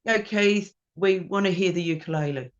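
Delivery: imitating rowdy supporters, half-shouted; retold crowd cheer. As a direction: cheer on the band like fans. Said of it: "they just screamed out loud and went".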